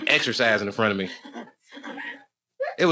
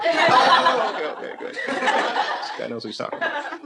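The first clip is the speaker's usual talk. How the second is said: laughing mock approval; 11 semitones higher, slower than usual. Mock reply to audience heckle.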